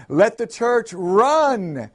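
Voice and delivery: high, drawn-out preacher's call; preacher's shout. A narrator's reading. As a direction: cry it out, stretching the last word. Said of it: "He shouted".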